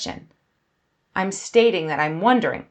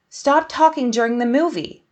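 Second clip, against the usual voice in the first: higher, emphatic order to be silent; firm command; 5 semitones higher, 3 dB louder than usual. Shown as a demonstration. Teacher ordering someone to stop talking during a film, firm command. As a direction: give it as a sharp order. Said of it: "I am ordering you to stop talking".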